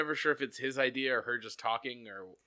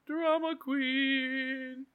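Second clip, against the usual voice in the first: high, very drawn-out echo; singsong teasing call; 12 semitones higher, much slower than usual. Pretend. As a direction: stretch it out on a high held note. Echoes co-host's phrase as a singsong call.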